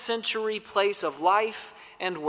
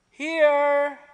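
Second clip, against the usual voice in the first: calling back; 7 semitones higher than usual. Retold or imitated. Answer from fog.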